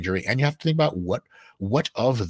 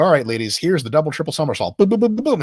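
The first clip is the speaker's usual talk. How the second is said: mock gymnastics coach; 5 semitones higher, 5 dB louder than usual. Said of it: "take a bunch of six-year-olds and go".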